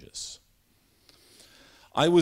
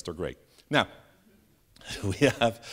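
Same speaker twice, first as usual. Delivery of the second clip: ordinary talk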